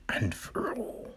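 breathy dramatic whisper. Acted. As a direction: whisper it like a secret ending. Dramatic end of plot summary.

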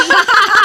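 high loud chuckle; short laugh. Real, not acted. Father laughs while telling about his daughter. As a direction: laugh mid-story.

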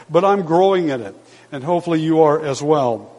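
ordinary talk, nothing marked

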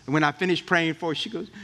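ordinary talk

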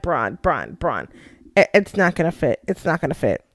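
low, soft, resigned aside; deadpan discouraging. Retold or imitated. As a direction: quietly try to talk him out of it. Helpless aside to wrestler forcing door.

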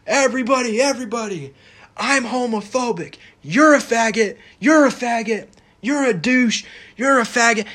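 mocking street crier, high and loud; mock public proclaimer. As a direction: play a bigot shouting it in the street. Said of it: "a person regularly going out saying".

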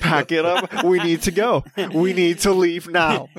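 hammed-up alarm urging everyone to pack up; retold panic. Son urging his parents to leave the bear area, mock panic.

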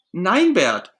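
high, loud outraged protest; indignant Ernie. Acted. Ernie protesting that Bert owes him the tour, indignant. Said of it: "Ernie ist empört".

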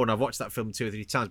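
ordinary talk, nothing marked

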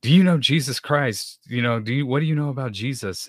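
hint of a fervent stranger asking about Jesus; eager street preacher. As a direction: mildly play the earnest evangelist stopping a passerby. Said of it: "he looks at me and says".

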